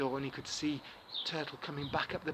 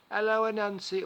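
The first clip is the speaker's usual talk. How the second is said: higher, louder cheery hello; Turtle greeting; 8 semitones higher, 8 dB louder than usual. Acted. Turtle greets Anansi on the beach, cheery and loud. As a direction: call out warmly from the sea.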